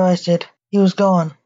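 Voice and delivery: ordinary delivery, nothing marked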